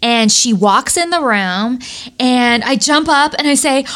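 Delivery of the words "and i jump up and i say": ordinary delivery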